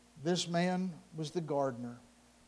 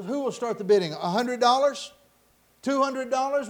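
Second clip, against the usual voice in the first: loud, lifted sales call; auctioneer calling bids; 6 semitones higher, 8 dB louder than usual. Acted. Auctioneer seeking an opening bid, calling out loudly. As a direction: throw figures out to the crowd.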